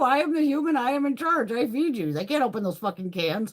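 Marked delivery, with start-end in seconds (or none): 0.0-2.1 higher, louder proud proclamation; hammed-up declaration of authority; emphatic declaration. Shown as a demonstration. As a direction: announce it like the boss of the house. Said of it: "It's true".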